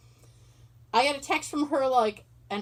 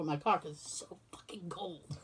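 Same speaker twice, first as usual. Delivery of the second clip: whispered aside; 5 semitones lower, 11 dB quieter than usual. Real, not acted. Complaining about the cold.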